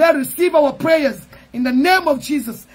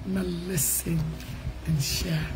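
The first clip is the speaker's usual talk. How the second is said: very low, soft goodbye; hushed sign-off; 10 semitones lower, 12 dB quieter than usual. Real, not acted. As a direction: sign off tenderly in a low, quiet voice. Closing farewell to the listeners.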